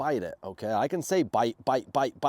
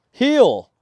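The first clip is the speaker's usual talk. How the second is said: "Heel" much higher and louder, stern; stern command; 10 semitones higher, 13 dB louder than usual. Shown as a demonstration. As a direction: snap "Heel" sternly to fix the dog. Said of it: "a little more stern voice".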